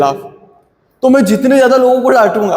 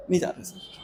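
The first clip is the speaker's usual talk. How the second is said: breathy whisper, no sir; whispered polite refusal; 16 dB quieter than usual. Pretend. Teacher playing a student calmly refusing to go home, whispered. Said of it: "मुस्कुरा के मना कर दोगे".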